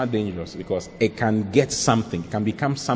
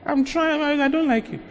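squeaky high, coy imitation of a woman refusing; mocking girly voice; 14 semitones higher, 5 dB quieter than usual. Shown as a demonstration. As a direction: mimic a timid woman's weak no. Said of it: "I've been saying it for thirteen years".

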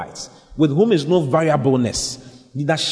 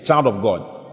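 normal talking voice

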